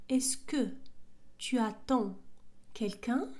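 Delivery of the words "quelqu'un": usual speaking voice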